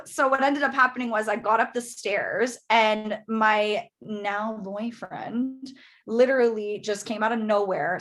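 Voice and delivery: ordinary delivery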